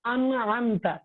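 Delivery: ان said with the nun fully clear, then امتا; demonstrating a clearly pronounced nun in ان امتا; clear izhar demo. Shown as a demonstration. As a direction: sound out the nun plainly in ان and امتا. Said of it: "ہم پڑھیں گے".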